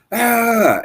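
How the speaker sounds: high-pitched imitated yell; imitating the other driver yelling at him; mock yell. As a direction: squeal it like the angry driver. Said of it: "he starts yelling at me".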